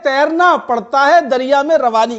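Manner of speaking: measured Urdu poetry recitation; tender romantic poetry. Acted. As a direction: recite each couplet with feeling. Poet reciting at mushaira.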